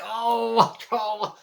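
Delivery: long, high "ohhh"; hammed-up drawn-out cry of effort; mock straining wail. Acts out straining at work.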